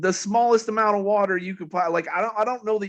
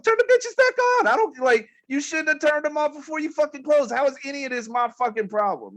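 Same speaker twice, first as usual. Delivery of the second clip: pissed-off rant; 10 semitones higher than usual. Retold or imitated. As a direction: vent the frustration, pitch climbing. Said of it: "So I'm getting all pissed off".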